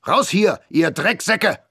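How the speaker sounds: high, loud barked order; yelling captain. A narrator's reading. Captain chasing squatters out, yelling. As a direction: bellow them out the door.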